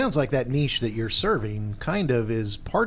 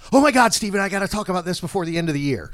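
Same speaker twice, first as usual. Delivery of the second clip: panicked client; 7 semitones higher, 6 dB louder than usual. Retold or imitated. Client panicking about year-end deadline.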